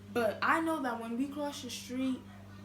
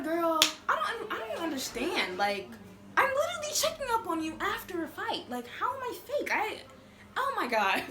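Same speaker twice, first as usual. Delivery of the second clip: louder, high disbelief; incredulous; 6 semitones higher, 5 dB louder than usual. Retold or imitated. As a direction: protest in laughing disbelief. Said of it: "I was just like".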